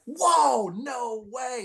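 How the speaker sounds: high, starstruck "whoa" falling away; mock-awed outburst, starting loud and high; retold amazement. Fan spotting bench players he idolized, retold amazement.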